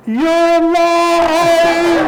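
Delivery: high, loud, slow drawn-out cry of joy; hammed-up disbelieving joy, stretched way out; overjoyed cry. Groggy patient sees his fiancée alive, slow high loud joyful cry. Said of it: "and I'm like".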